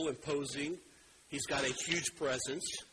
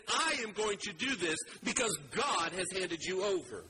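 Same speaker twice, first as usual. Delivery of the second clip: lightly raised defiant cry, slowing at the end; David shouting defiance at Goliath, mildly raised; proclaimed shout; 6 semitones higher than usual. Acted. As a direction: proclaim each threat high and bold. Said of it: "He is shouting it".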